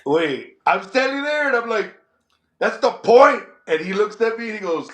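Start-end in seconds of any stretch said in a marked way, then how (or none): 2.6-3.4 retold retort.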